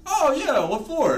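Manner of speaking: high, bright-eyed clueless reply. Retold or imitated. Clueless guy answering the girls asking for his number. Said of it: "me being as dumb as I am was like".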